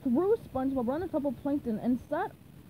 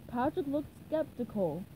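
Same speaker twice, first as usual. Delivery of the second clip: usual speaking voice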